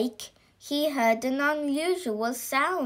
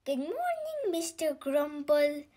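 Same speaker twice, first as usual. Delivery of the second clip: high, soft sing-out greeting; cheery greeting; 4 semitones higher, 5 dB quieter than usual. Acted. Mister Happy greets Mister Grumble at the lake, cheery. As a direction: greet sweetly in a high little voice.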